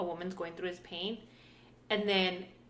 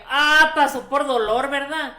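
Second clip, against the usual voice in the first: loud, higher mock exclamation; retold pissed-off outburst; 5 semitones higher, 15 dB louder than usual. Retelling an argument with Luis about pain, mock-indignant outburst. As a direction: burst out as if fed up. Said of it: "I got so pissed".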